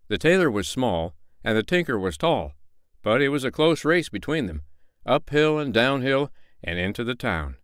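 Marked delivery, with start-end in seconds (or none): none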